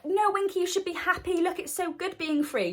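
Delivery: Dobby voice. Retold or imitated. Dobby cheering up Winky, high eager elf voice. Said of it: "Dobby's there like".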